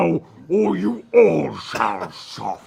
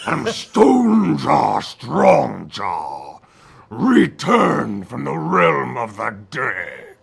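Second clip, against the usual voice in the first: booming ghostly voice; 5 semitones lower than usual. Acted. Dead warrior announces his return, booming low and ghostly. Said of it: "you hear a voice come booming".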